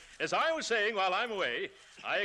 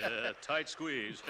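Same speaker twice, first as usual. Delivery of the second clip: chuckling at own pun; 7 semitones lower, 5 dB quieter, much slower than usual. Acted.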